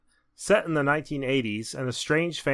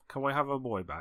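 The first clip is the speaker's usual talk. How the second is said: softer, lightly voiced Cockney Michael Caine; Michael Caine impression; 9 dB quieter than usual. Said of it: "This is Michael Caine".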